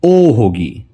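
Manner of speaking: ordinary talk, nothing marked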